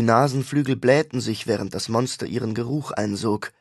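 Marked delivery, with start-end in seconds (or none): none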